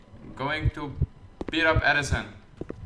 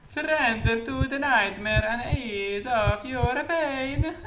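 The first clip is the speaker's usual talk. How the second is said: high, drawn-out mock Italian accent with a chuckle; slow, singsong Italian villain, pitched way up; 11 semitones higher, slower than usual. Gamer voicing a creepy game note, high Italian voice. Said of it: "give him back the Italian voice".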